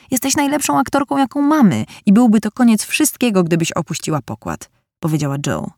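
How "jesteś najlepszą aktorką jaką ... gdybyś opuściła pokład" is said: butter her up, playfully; gushing praise. A narrator's reading. Jo coaxes Meg to keep acting, in a higher gushing voice.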